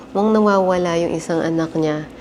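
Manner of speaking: ordinary talk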